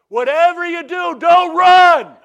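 very high, very loud laughing scream of warning; retold scream. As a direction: scream the warning across the yard. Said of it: "screaming at me".

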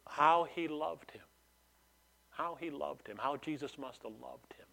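very soft, falling to a near whisper; hushed wonder. Acted. Onlookers watching Jesus weep at the tomb, hushed. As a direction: let the words sink away, tenderly awed.

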